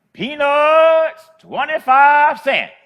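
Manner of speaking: high, loud, drawn-out peanut seller's call; street vendor's cry. Retold or imitated. Old lady selling peanuts calls to passing traffic, loud high street cry. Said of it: "she yells out".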